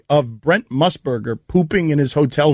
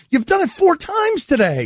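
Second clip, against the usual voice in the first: high-pitched exasperated reproach; 12 semitones higher than usual. Real, not acted. Host scolding co-host for delaying the segment, exasperated. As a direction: call him out, voice shooting up.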